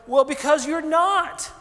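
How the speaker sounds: high, loud, slow comeback; exasperated mock answer, drawn out; blunt retort. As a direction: throw it back as if obvious. Bluntly answering friend's complaint.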